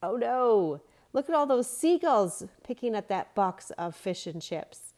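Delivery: high, loud oh no; alarmed boy. A narrator's reading. Boy seeing seagulls at the rubbish, loud alarmed oh no. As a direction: sound the alarm to friends. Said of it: "Luke ran over".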